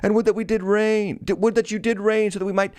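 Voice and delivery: high-pitched mock congratulation; sarcastic scripture quote, voice held high. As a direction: read it with pointed sarcasm, pitched well up. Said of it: "That's all sarcastic language right there".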